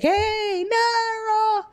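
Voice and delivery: high put-on voice for the friend; mock friend greeting. Retelling a friend's greeting to Naro in a high mock voice. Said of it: "He's like".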